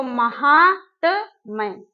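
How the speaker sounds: महात्म्य stretched slowly, each syllable clear; slow correct word. Shown as a demonstration. Teacher repeating correct word, slow and deliberate. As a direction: linger over महात्म्य. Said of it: "सही शब्द क्या होगा?".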